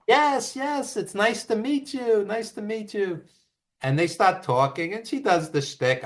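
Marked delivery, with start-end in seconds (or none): 0.1-3.2 gracious reply. Retold or imitated. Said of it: "the warmest, nicest guy".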